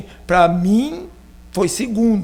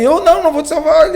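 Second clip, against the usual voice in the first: quick, loud high refusal from the friend; recusa gritada; 8 semitones higher, 8 dB louder, faster than usual. Retold or imitated. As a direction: fire off the refusal fast and loud. Amigo recusa salvar. (amigo recusando salvar, em voz alta e rápida)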